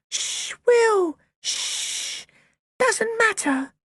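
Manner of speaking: high, small hushing voice; calm him down gently; small sister soothing her shouting brother; little girl hushing. A narrator's reading.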